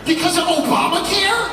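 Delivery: high, loud incredulous yell; outraged disbelief. Retold or imitated. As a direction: bellow it in disbelief through laughter. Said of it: "incredulous echo".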